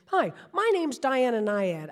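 loud, brash New York pitch, slowed down; a brassy New York accent, announcing herself; thick New York accent. Retold or imitated. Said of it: "my spiel in a thick New York accent".

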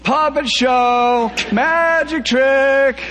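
very high, slow, loud squeal. A narrator's reading. Brit smacking the Romeos, squealing very high. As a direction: squeal it long and shrill with glee. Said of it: "the whole time squealing".

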